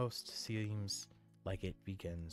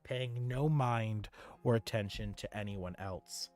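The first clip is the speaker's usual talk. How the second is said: ordinary talk, nothing marked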